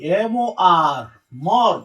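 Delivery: teacher stretching out "এম ও আর, মর" as one syllable; broken-down pronunciation. Shown as a demonstration. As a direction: spell "এম ও আর" slowly, then sound out "মর". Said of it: "উচ্চারণটা ভাঙ্গে পড়ি".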